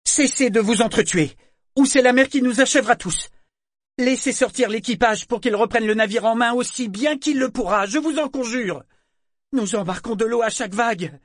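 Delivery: put-on high, loud boy's command; forced authoritative tone. A narrator's reading. Boy ordering rebels to stop killing each other, authoritative shout. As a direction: shout orders, trying to sound grown-up. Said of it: "il s'efforça de prendre un ton autoritaire".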